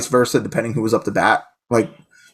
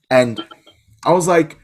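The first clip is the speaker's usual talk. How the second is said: usual speaking voice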